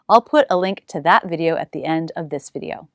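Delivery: usual speaking voice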